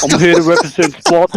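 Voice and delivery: put-on Australian fighter talk; Australian accent impression. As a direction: taunt a rival in a broad Australian accent. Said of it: "that's a good Australian impression right there".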